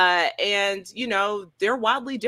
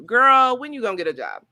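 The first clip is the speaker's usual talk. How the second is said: loud, higher mock-scolding call of girl; quoting a sitcom line; 7 semitones higher, 6 dB louder than usual. Quoting the show's character to the host, mock scolding. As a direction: belt it out like the classic sitcom character. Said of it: "we got a Tommy Light character too".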